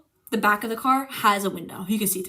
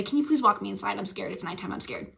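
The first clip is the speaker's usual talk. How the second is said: soft, lower, timid plea; scared small voice; 4 semitones lower, 9 dB quieter than usual. Retold or imitated. Asking mom to walk her inside, a timid murmur. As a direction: shrink into a frightened murmur. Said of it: "I say".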